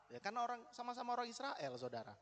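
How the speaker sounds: usual speaking voice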